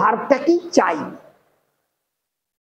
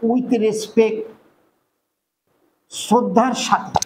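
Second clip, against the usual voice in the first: slow dictation; much slower than usual. Shown as a demonstration. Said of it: "লিখে দিই আমরা".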